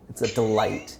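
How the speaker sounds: usual speaking voice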